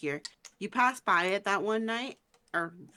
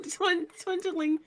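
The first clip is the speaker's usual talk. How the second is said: higher, drawn-out tongue-tied laughing; laughing stumble; 8 semitones higher, slower than usual. Real, not acted. Game master fumbling the shop name, tongue-tied and laughing. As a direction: stumble over "twindling" through laughter.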